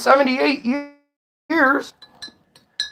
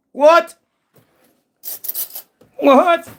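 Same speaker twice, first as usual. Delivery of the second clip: mock disbelief; 6 semitones higher than usual.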